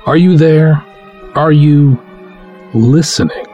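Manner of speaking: ordinary talk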